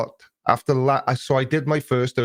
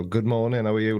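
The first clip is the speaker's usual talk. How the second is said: normal talking voice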